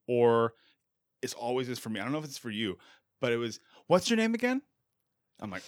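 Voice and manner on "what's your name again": high-pitched, pointed mock demand; imitated testy caller. Host mimics a caller coming in hot, testy. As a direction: pitch the question up like a caller spoiling for a fight. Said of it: "they're coming in hot".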